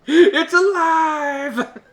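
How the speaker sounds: high, long-drawn mock-horror cry; hammed-up triumphant cry. Joking to wife about the coughed-up thing, hammed-up triumph. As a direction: proclaim it slowly, high, through laughter. Acted punchline after laughing fit.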